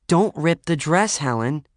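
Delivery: high, slow, pleading caution; nagging warning. A narrator's reading. Lorraine to Helen in a too-tight dress, nagging. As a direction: warn her with worried insistence.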